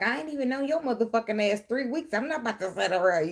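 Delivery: imitating a dismissive lover; mocking dismissive person. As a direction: play the impatient skeptic. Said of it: "You know how people like".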